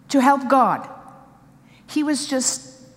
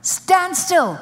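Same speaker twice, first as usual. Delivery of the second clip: higher and louder, STAND STILL snapped out; loud command; 6 semitones higher, 4 dB louder than usual. Acted. Holy Ghost telling a panicking believer, loud stern command. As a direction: bark stand still as an order.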